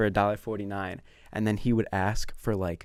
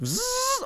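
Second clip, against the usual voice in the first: high buzzing zzz; imitated window buzz. Storyteller miming the window opening, high buzz. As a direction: buzz like a sliding window motor.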